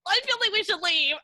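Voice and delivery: high, loud, uneasy aside; wanting to leave the grieving owner; awkward unease. Retold or imitated. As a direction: sound keen to slip away. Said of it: "And I was like".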